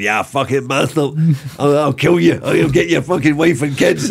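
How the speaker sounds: imitating a furious player's loud, high yell; screaming at the referee. Retold striker screaming abuse at the English referee, loud and high. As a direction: bellow the threats like a raging striker. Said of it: "screaming at the referee".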